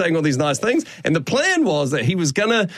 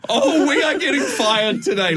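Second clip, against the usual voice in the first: laughing panic; 8 semitones higher than usual. Retold or imitated. Panicking as the prank collapses.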